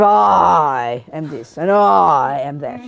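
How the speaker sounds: low, hugely drawn-out "I"; slow, hammed-up pomposity on "I"; self-important ego. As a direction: stretch each "I" out grandly, sinking low.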